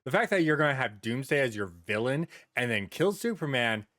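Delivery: usual speaking voice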